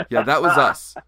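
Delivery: nail the reveal loudly; triumphant shout. Retold or imitated. Confronting the band.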